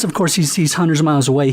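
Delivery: ordinary delivery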